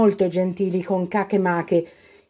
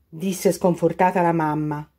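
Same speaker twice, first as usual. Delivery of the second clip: plain reading voice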